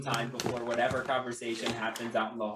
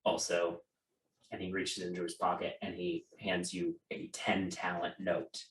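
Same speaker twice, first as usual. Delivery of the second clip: usual speaking voice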